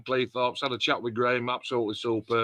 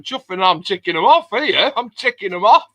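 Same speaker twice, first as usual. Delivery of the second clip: higher, louder fed-up mock rant; exasperated outburst; 8 semitones higher, 8 dB louder than usual. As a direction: blurt it out like you've had enough of the questions. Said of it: "I'm like".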